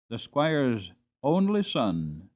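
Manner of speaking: plain reading voice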